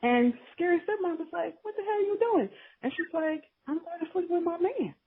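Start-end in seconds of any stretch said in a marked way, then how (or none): 1.6-2.5 high, indignant stepmom screech; mock outraged stepmom. Stepmom confronts girl. 3.7-4.9 high, innocent ditzy voice; sweet and clueless, as if obvious; airy ditzy girlfriend. Retold or imitated. Ditzy girlfriend answers stepmom.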